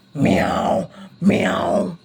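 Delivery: low whispered cat meows. Shown as a demonstration. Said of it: "what's the sound?".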